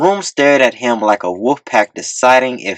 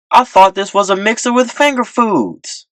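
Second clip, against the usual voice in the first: flustered pleading; 9 semitones higher than usual. A narrator's reading. T-Real pleading, hands raised.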